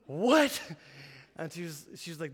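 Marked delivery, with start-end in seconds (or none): 0.0-0.6 sharp gasp, then a loud rising "What?"; startled gasp. Retold or imitated. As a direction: caught completely off guard. Wife says labor has started.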